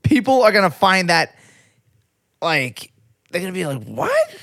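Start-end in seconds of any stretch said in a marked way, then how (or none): 3.8-4.4 shocked high squeak; mock baffled what. People finding dirty toilet paper.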